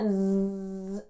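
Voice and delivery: soft buzzing Zzz like a little bee; quiet imitated bee buzz. Shown as a demonstration. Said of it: "como si fuera un zumbidito de".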